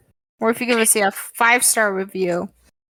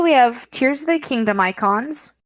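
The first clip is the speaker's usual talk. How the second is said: ordinary talk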